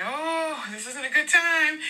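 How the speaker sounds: high, drawn-out mock-dismayed thought; reluctant inner groan. Speaker thinking to herself as the cashier stops her, mock dismay. Inner reaction to being stopped in a hurry.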